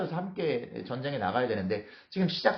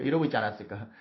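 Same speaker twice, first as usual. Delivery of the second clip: ordinary delivery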